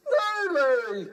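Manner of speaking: high, loud, whiny little puppet voice; a tearful-sounding puppet squeak; squeaky puppet. Acted. Puppet telling the host its name, high and whiny.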